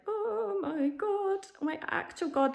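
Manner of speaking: high, long-drawn wail of dismay; mock horror. Patient facing endless tests, hammed-up inward horror. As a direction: stretch it out high, hamming up the dread.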